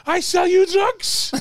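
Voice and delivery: high, slow sing-song; mock toilet attendant. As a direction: stretch it out high and slow like a dodgy seller. Imitating the drug-selling attendant.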